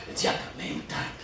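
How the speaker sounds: soft whispered closing line; whispered ending. Acted. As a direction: drop it to a whisper to finish. Whispered end of the poem.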